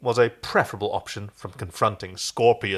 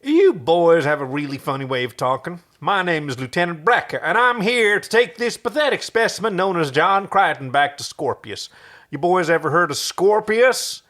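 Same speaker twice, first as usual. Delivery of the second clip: put-on louder southern accent, smirking; southern drawl; 5 semitones higher, 4 dB louder than usual. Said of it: "in his best southern drawl".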